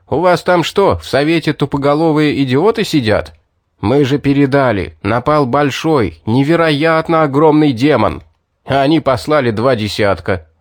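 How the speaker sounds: loud furious rant; angry outburst. Acted. Furious villager berates the sergeant.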